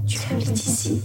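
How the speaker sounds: distant whispered voice; dream guide. A narrator's reading. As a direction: call softly from far away. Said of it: "une voix lointaine".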